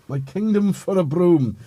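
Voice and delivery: mock-theatrical plea. Parodies Richard III line for broom.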